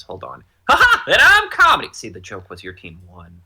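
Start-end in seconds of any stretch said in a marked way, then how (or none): 0.7-1.9 very high, loud manic cheer; squeaky over-the-top jolly co-host; Comedy mask. Acted. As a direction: shriek it gleefully with a cackling ha ha. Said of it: "I can do both voices".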